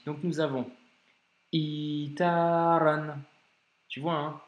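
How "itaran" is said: itaran, slow, a tiny catch before a long i; slow Arabic reading model; glottal stop demo. Shown as a demonstration. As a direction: block the breath, then stretch the i in itaran. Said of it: "On doit bloquer i".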